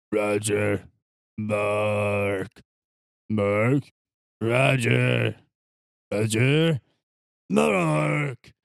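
slow, drawn-out zombie moans; arguing zombies. Acted. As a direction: groan each name like a bickering zombie. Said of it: "you just see these two zombies".

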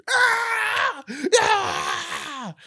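high yelps with laughter creeping in; mock pained scream. Acting out Owen at the tattoo parlor, screaming. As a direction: shriek as if pricked by a needle. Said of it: "He gets, like, pricked".

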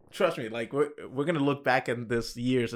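normal talking voice

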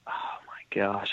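breathy aside, almost to herself; whisper. Real, not acted. Cohost forgetting the fighter's name, whispering to herself. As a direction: whisper it as you search your memory.

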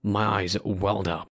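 plain reading voice

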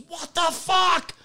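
very high, drawn-out mock wail; hammed-up high yelp of outrage, stretched slowly; imitated pained outcry. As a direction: cry out as if just hit. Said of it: "He jumps back and goes".

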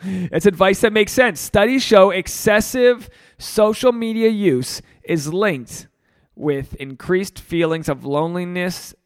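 usual speaking voice

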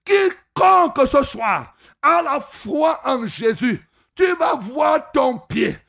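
ordinary talk

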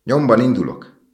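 high, loud announcement; excited calling out. A narrator's reading. Swallow cries out it is leaving.

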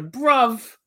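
put-on British accent. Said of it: "I can't do a British accent".

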